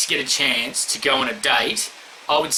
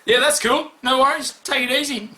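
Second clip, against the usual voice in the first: hammed-up easygoing boyfriend; 7 semitones higher than usual. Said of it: "I'd be like".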